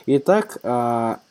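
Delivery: ordinary talk, nothing marked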